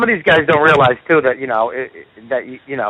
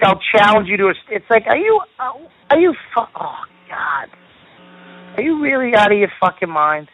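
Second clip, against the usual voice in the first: high-pitched, incredulous ranting; hot and bothered; 5 semitones higher than usual. Real, not acted. Comic railing at the emailer who challenged him, hot and bothered. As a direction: sputter in exasperation, voice climbing high. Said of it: "you get all hot and bothered".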